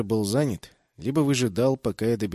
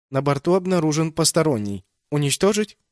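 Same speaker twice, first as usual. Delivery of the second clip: ship computer voice; 5 semitones higher, 6 dB louder than usual. A narrator's reading. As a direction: report it like a ship's AI. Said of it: "спросил корабль". (голос бортового компьютера)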